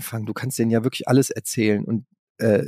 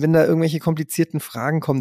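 normal talking voice

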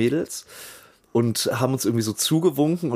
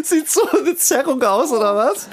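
much higher, louder mock sarcasm through laughter; sarcastic laughing retort; 13 semitones higher, 4 dB louder than usual. To his friends who called it a sprain, loud high sarcasm while laughing. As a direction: throw their diagnosis back at them, cracking up. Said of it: "Und ich so zu meinen Leuten".

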